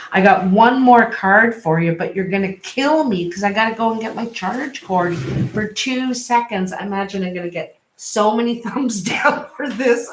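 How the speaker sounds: ordinary talk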